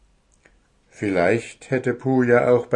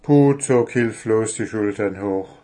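ordinary delivery, nothing marked

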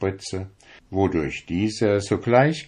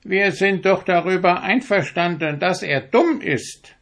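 smug ducks; 10 semitones higher than usual. A narrator's reading. Ducks mock the stork.

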